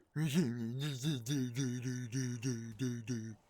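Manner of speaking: long, loud, high nonsense babble; mock frenzied gibbering. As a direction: gibber wildly in one long hammed-up run. Imitates the aggressor, clowning wildly.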